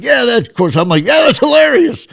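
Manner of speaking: hammed-up delight; mock delighted gush. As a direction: gush like a thrilled employee. Faked delighted reply to boss.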